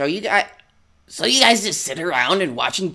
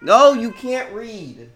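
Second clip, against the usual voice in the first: louder, higher, slow scolding; telling the cat off; 7 semitones higher, 6 dB louder, slower than usual. Real, not acted. Owner scolds the cat who wants to read, loud and slow. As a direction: firmly refuse the cat.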